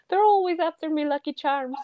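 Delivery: giggling mock Irish leprechaun; leprechaun Irish accent. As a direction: quote the cereal leprechaun in a jokey Irish lilt. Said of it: "the leprechaun from Lucky Charms".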